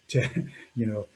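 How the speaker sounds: ordinary talk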